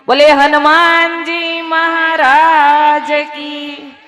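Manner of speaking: slow, loud, high drawn-out chant call; call to the crowd. Real, not acted. Preacher calling the women to shout Hanuman's praise, slow and loud. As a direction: stretch every syllable to rouse the hall.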